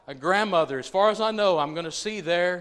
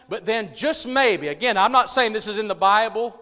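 loud, raised, insistent sermon litany; full-force preaching; 6 semitones higher, 8 dB louder than usual. Acted. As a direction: drive each phrase home to the congregation.

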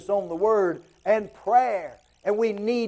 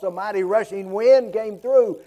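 normal talking voice

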